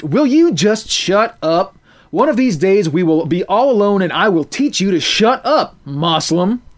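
angry rebuke. A narrator's reading. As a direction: threaten him through your teeth.

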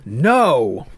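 loud shouted no. A narrator's reading. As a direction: yell it back together. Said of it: "shouted back in unison".

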